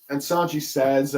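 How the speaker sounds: ordinary talk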